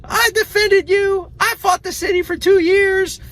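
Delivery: hammed-up high-pitched mother scolding her kids; mock mom voice. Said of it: "And my mom goes".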